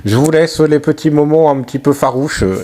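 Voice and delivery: loud, fast recap voice; voice-over narration. Real, not acted. (voix off de narrateur)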